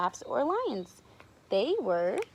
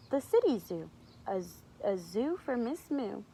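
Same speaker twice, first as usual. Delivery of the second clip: ordinary delivery, nothing marked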